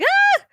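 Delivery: short high-pitched mock scream; imitated squeal of fright; cartoon freak-out shriek. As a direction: squeal like someone leaping onto a chair. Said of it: "people freaking out".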